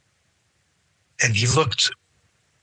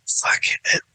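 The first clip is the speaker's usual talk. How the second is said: whispered; 4 dB quieter than usual. Retold or imitated. Muttered reply to bad news.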